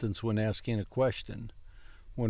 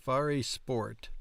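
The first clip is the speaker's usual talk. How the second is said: normal talking voice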